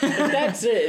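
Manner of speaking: slow, laughing through the words; chuckling while speaking. Real, not acted. Laughing about her mom.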